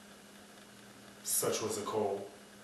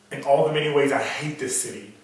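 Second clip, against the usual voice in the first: louder, bitter snarl at the city; bitter outburst; 4 semitones higher, 9 dB louder than usual. Acted. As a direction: spit out his hatred.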